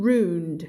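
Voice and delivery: "ruined" as roond, ending on a d. Shown as a demonstration. Teacher adding ed for students, "ruined" as roond. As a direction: say "ruined" in one drawn syllable closed with d. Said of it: "เสียง d นะ ก็เลยต้องอ่านเป็น".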